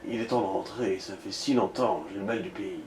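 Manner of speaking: low, soft self-serious voice. Acted. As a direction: play the man too serious. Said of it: "fais-lui une voix sérieuse".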